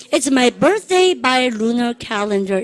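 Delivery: each syllable dragged out very slowly; slow choral model. Shown as a demonstration. Teacher leads the class through the birthday sentence, very slow. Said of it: "It's my 시작".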